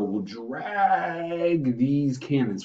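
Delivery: "drag" stretched very long; DRAG drawn out. Real, not acted. Teacher describing cannons hauled to Boston, "drag" drawn out long. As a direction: haul out the word "drag" slowly. Word drawn out to show hauling.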